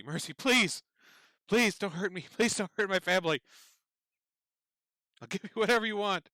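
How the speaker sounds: high, loud, panicked pleading; begging for mercy. Acted. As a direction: grovel on the floor, terrified. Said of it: "begging for mercy".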